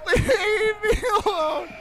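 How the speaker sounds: laughing mock cry. Repeating line, laughing and crying.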